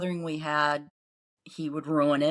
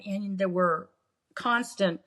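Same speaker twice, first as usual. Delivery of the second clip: ordinary talk, nothing marked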